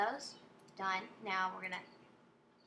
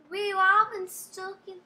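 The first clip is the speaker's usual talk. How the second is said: loud, high put-on British accent; attempted British accent; 6 semitones higher, 9 dB louder than usual. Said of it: "I'm trying to go British".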